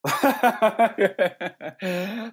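high nervous giggle; nervous laugh. Real, not acted. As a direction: laugh nervously, caught off guard. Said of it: "there's my nervous laughter".

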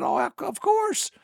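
high, slow imitation of a grandchild; grandchild's reply. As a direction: lift the voice way up like an obliging grandkid. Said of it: "one of my grandkids".

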